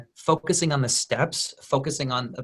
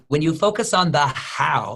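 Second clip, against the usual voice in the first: ordinary talk, nothing marked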